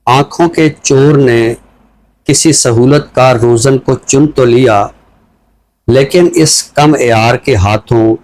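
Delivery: slow, measured reading of a free verse poem; poem recitation. Acted. Host asked for a poem, he recites it slowly. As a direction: let each line land with a pause.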